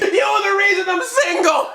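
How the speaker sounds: put-on high whiny shouted kid voice; high mock tantrum shout; mock kid yell. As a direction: yell it like a bitter little kid. Kid yelling at absent dad.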